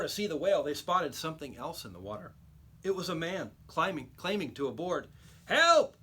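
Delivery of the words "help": high, loud call for help, rising; man in the sea yelling help; drowning man's cry. A narrator's reading. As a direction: shout for help from the water.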